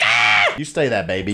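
normal talking voice

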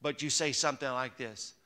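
ordinary talk, nothing marked

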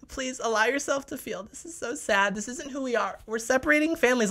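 mocking teary activist voice; mock weepy celebrity. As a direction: parody her sobbing sincerity. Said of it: "she's literally crying".

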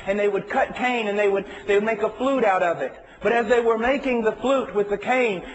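full-force sermon delivery; preaching at full force. Acted. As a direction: preach it out with rising heat. Preaching the cane story.

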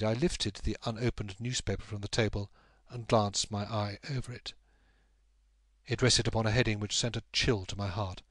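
ordinary delivery, nothing marked

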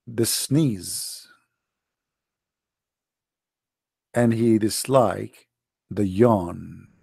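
slow solemn reading; solemn recital. Acted. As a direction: intone it like scripture.

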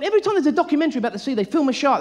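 ordinary talk

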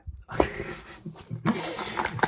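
breathy whispered giggle; whispered laugh. Real, not acted. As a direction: laugh under your breath. Laughs after being caught.

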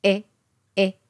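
"え" halfway between Japanese i and e; short, lax "え" leaning to i; vowel between i and e. Shown as a demonstration. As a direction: say "え" shaded toward i. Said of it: "日本語のいとえの中間の音".

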